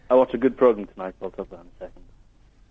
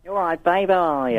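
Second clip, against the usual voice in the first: higher, put-on drag queen greeting; 7 semitones higher than usual. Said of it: "I thought I'd speak if I was a drag queen".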